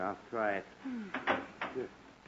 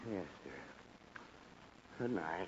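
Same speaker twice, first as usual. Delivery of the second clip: much softer and lower, nearly asleep; drowsy giving in; 11 semitones lower, 19 dB quieter than usual. Acted. Husband gives in to his wife at bedtime, sleepy and soft. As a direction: mumble it off as you drift away.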